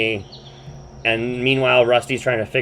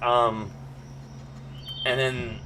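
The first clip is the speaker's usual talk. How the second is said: ordinary talk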